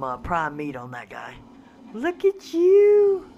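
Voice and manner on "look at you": adoring coo. Real, not acted. Player gushing over a new pterodon, slow high coo. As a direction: gush over it like a kitten.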